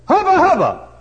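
loud cheer; hollered. Retold or imitated. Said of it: "touch the top and say".

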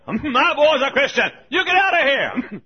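high, loud imitated yell; mock screaming mother; hollering mother. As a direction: holler as a protective, outraged mother. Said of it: "she hollered and screamed at him".

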